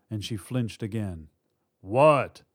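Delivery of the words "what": short, loud shout back; shouted reply. A narrator's reading. Robber at teller window to partner, loud shout. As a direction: answer a call from across the room. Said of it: "another man shouted from the teller window".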